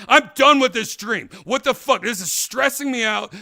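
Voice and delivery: exasperated outburst; fed up. Retold or imitated. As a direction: vent it, done with this nonsense. Waking up angry from dream.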